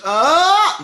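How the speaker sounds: tight, squeezed high ah sung up the range; pinched ah. Shown as a demonstration. Coach demonstrating to students a pinched, strained high ah. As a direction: show the wrong, pinched way to go up. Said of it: "they pinch".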